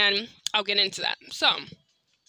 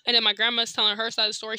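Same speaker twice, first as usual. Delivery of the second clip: ordinary talk, nothing marked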